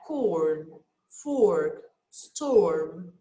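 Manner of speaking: "corn", "fork", "storm" said low and clear; teacher showing OR words; careful word model. Shown as a demonstration. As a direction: model each OR word for the class. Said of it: "Let's see the words with O R".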